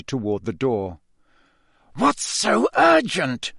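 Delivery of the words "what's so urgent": high, slow, pointed hiss; hissing Snape. A narrator's reading. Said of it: "Snape hiss at Karkaroff".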